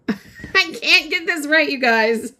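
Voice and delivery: high, giggly frustration; real embarrassed giggle; laughing at herself. Real, not acted. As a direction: laugh through the admission. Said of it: "I'm the worst".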